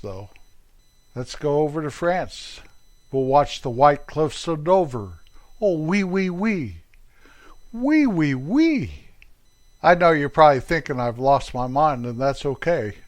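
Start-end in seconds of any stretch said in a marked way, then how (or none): 5.6-8.9 high, playful fake French exclamation; mock French oui oui. Vlogger hamming up a French oui oui. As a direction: trill it like a comic Frenchman.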